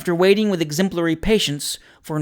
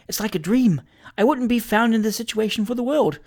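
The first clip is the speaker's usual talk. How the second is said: high, mildly flustered woman's voice; a touch of panicky propriety; fretful alarm; 7 semitones higher than usual. A narrator's reading. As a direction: fret in a lifted pitch. Landlady fretting at being discovered.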